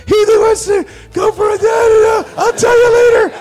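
dad shouting an answer to crew; calling out. Retold or imitated. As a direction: yell it across the set.

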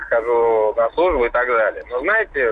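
ordinary delivery

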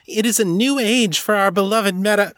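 very high, declaiming little elf; Clippy. A narrator's reading. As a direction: pitch it right up and make a grand proclamation. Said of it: "Clippy pushed out her chest".

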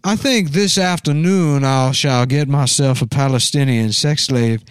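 teasing impression of a genteel Southern dandy; mock Southern dandy voice. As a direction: draw it out like a Southern gentleman. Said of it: "My cool Southern dandy voice".

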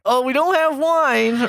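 high, slow innocent voice; coy denial. Retold or imitated. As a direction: play dumb in a sugary high voice. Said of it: "And we're like".

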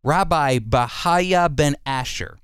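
ordinary delivery

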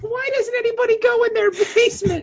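high, fake crying plea; mock sobbing. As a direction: wail it through fake tears.

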